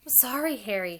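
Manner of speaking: high, loud, distressed apology; wailed apology. A narrator's reading. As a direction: wail it out to a friend. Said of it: "she wailed".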